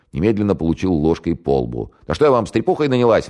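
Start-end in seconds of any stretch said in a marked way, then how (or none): 2.1-3.3 fast, high, loud indignant outburst; indignant housewife. Acted. Fake mother snaps at the men in her role, fast and loud. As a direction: snap back like a fed-up housewife. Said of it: "вышла из роли".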